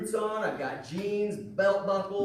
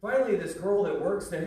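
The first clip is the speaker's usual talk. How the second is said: ordinary talk, nothing marked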